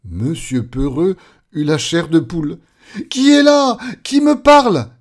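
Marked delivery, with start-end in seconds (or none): 3.1-4.9 high, very loud startled questions; terrified scream. Acted. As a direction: shriek it in a panic. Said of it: "hurla-t-il". (questions hurlées de terreur)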